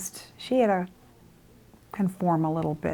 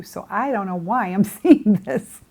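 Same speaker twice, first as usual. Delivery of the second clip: laughing; 5 dB louder than usual. Real, not acted. Puzzled over the vision.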